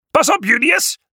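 urgent, high-pitched warning cry; Cornelius. A narrator's reading. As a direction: call out a warning to a friend. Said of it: "waarschuwde Cornelius". (hoge, dringende waarschuwingskreet)

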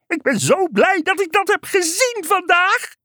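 very high, overjoyed frog voice; super-enthusiastic frog. A narrator's reading. Frog delighted by the trick, super-enthusiastic. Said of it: "kwaakte de kikker superenthousiast".

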